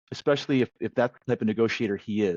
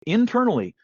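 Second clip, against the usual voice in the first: ordinary delivery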